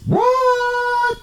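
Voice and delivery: a stretched, very high what; incredulous what. Retold or imitated. Retelling the moderator's insult, a high stunned what. Retold reaction to Reddit moderator.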